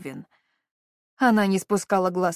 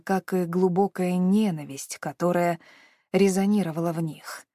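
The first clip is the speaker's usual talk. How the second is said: ordinary delivery, nothing marked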